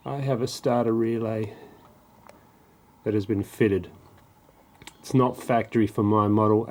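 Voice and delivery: ordinary talk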